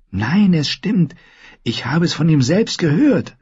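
put-on high, emphatic girl's voice; high-pitched, earnest assurance; insistent girl. A narrator's reading.

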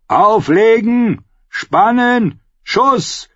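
high, drawn-out battle orders; shouted commands. A narrator's reading. Commander drilling archers in battle, shouted commands. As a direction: shout orders across the wall.

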